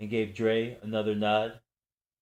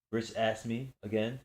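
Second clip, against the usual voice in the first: usual speaking voice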